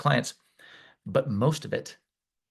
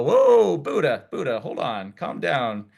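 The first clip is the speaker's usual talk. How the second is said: put-on teasing kids; 4 semitones higher, 4 dB louder, slower than usual. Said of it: "they would go".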